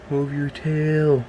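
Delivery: usual speaking voice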